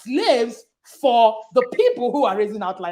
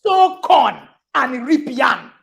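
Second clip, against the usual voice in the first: emphatic proverb; 4 semitones higher, 5 dB louder than usual. Retold or imitated. Mother to foster daughter, loud emphatic proverb. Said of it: "And I said to her".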